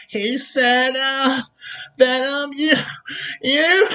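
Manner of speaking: high, hesitant mock whine; tattling whiner. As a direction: stammer like a snitch about to cry. Mocking a tattling accuser.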